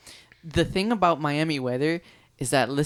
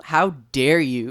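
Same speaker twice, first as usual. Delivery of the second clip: slow, dramatic mock outrage; mock offended; 7 dB louder, slower than usual. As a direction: play up being offended.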